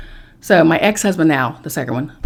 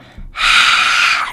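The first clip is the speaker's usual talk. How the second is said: long unvoiced exhaled hiss; imitating the being's breath; breathy hiss; 4 dB louder than usual. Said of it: "and then I went".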